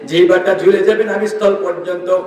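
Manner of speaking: ordinary talk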